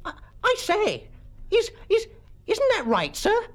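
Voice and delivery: very high, faltering schoolboy voice; panicky stammer. Acted. Bunter questions Mister Quelch, high panicky stammer.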